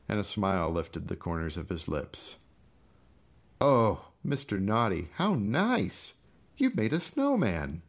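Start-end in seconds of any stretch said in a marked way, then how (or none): 3.6-7.8 high, loud fake delight; gleeful mock delight. Gus delighting over his frozen brother, high and mocking. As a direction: gloat over the frozen body.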